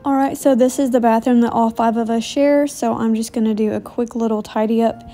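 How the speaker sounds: ordinary talk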